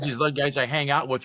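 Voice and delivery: usual speaking voice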